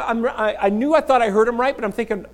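usual speaking voice

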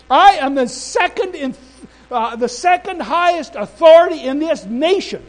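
loud, high, indignant pulling of rank; upset, angry official. Retold or imitated. Immigration minister asserting her authority over preacher, angry. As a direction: act out an official losing patience. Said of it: "starting to get upset now".

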